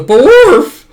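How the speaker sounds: sudden very high, loud yelp; mock bark. Bond breaking a wine glass, high loud yelp. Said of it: "glass breaking sound effect".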